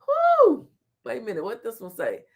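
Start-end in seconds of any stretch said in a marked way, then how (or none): 0.0-0.7 high loud exhale of relief; high whew. Real, not acted. Vlogger recovering from a laugh, high loud whew. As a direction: blow out a big high whew. Catching breath after laughing.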